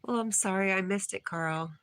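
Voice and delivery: usual speaking voice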